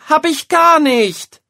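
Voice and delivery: very high, loud, slow yell of denial; shouting boy. A narrator's reading. Boy yelling at his mother, furious denial. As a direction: scream the denial. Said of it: "schreit Jan Arne".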